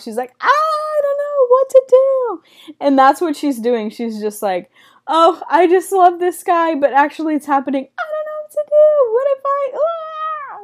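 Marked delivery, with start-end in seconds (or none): 0.4-2.4 very high, slow squealed wail; mock freaking out. Girl in a TV show loses it, hammed-up squeal. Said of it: "like, freaking out". 5.1-7.9 higher, giddy lovestruck gush; mock freaking out. Woman in love realises it is happening, giddy mock freakout. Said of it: "like, freaking out". 8.0-10.6 very high squeal trailing into a wail; mock freaking out. Woman overwhelmed by her crush, mock panicked squeal. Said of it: "like, freaking out".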